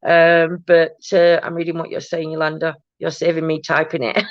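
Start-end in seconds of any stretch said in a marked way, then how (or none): none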